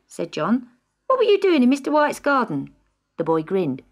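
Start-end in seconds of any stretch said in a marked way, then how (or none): 1.1-2.7 put-on curious boy. A narrator's reading. John questioning Ricky.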